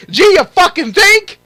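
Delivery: loud, high sarcastic outburst; sarcastic yell. Real, not acted. Gamer mocking the developer's excuse, sarcastic yell. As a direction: scream the sarcasm at the article. Sarcastic retort to article quote.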